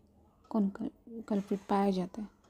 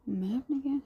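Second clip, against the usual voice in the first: voiced figure; 5 semitones higher than usual. Shivi's teasing reply.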